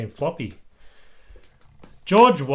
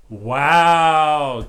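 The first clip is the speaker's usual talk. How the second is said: breathy amazed gasp, much louder; gasped wow; 8 dB louder than usual. Acted. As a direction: gasp in wonder at the moon. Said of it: "gasped the children".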